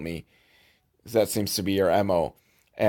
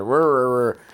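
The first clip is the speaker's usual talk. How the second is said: mock grumbling growls; grumpy growl. Left-behind wheelchair guy sulking, mock grumbling growls. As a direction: grumble like a sulking old man. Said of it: "he's all grumpy".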